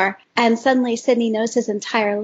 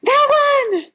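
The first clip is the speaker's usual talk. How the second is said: much higher, squealing "that one"; excited recognition; 13 semitones higher, 3 dB louder than usual. Real, not acted. Fan hearing her favorite podfic named, excited squeal. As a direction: burst out with delighted recognition.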